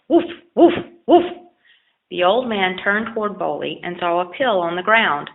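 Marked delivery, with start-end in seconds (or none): 0.1-1.3 high, loud yipping bark; yelping dog. A narrator's reading. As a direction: yip it out like a small dog. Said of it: "the dog yelped".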